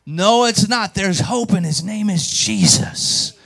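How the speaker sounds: blurted declaration. Retold or imitated. Preacher answering a hopeless man, bursting out with hope. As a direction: burst out with it, stretching the last words. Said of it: "I just blurted out".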